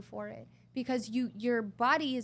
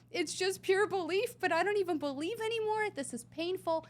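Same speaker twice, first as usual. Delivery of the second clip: rising higher in a breaking retold sob; retold crying; 9 semitones higher than usual. Retelling her crying video, pitch climbing in despair. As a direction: voice climbing as the belief cracks. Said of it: "I'm bawling".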